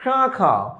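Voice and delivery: শাখা with the first vowel sent through the nose; nasalised vowel. Shown as a demonstration. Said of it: "এটা উচ্চারণটা কি হবে".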